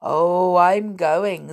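loud, solemn announcement. A narrator's reading. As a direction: intone it gravely. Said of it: "said Pink Whistle solemnly".

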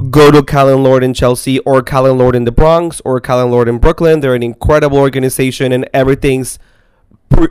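ordinary talk, nothing marked

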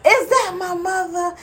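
high slow disbelief; surprised. Retold or imitated. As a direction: ask it high and slow, unsure. Drowsy mom hears her mother.

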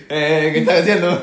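loud laughing shout; yelling while laughing. Retold or imitated. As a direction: yell it out, cracking up. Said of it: "empecé a gritar". (gritando entre risas)

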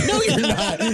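high, laughing no you're not; laughing retort. Retold or imitated. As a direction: tease them through a laugh.

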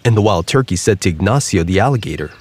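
usual speaking voice

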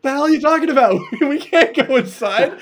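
laughing through a shocked objection; retold brothers yelling no; incredulous protest. Brothers refusing to go in.

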